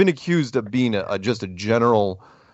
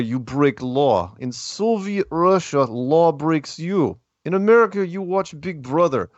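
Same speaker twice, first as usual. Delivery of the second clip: put-on Yakov Smirnoff-style Russian comic accent; playful mock Russian accent; 6 semitones higher than usual. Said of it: "I'm gonna do it in my Russian accent".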